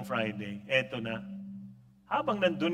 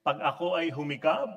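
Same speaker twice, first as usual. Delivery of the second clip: ordinary talk, nothing marked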